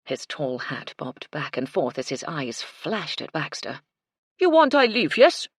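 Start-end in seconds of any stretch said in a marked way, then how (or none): 4.4-5.5 loud, high, indignant French chef; outraged French chef, much louder; French accent. A narrator's reading. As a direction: fling it at him in a huffy French accent. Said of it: "Michel's French accent".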